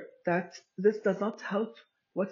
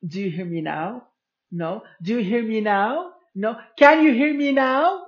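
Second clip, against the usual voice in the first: each question louder and higher, building to a shout; rising mock shouting; 4 semitones higher, 10 dB louder than usual. Woman demanding to be heard, building to a shout. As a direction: push it up step by step till you're yelling. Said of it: "it's as if they are saying".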